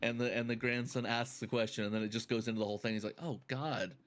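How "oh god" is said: mock dismay. Retold skit, dismayed reaction.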